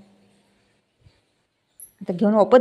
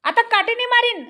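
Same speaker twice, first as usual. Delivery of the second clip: mock threat; 11 semitones higher than usual.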